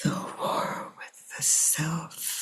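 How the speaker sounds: a slow, hushed, sorrowful aside; hushed whisper. Real, not acted. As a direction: let it fall to a grave whisper. Grave reflection on inner war.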